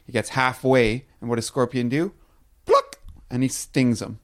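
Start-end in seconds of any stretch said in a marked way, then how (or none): 2.6-3.0 very high, sharp sting noise; stinging sound effect. Acted. Narrator voices the scorpion's sting as a high, sharp pop.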